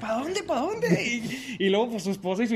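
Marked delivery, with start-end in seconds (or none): 0.0-1.0 high, slow panicky questions, laughing; flustered neighbour asking where to go; high flustered mock panic. Neighbour panicking about leak.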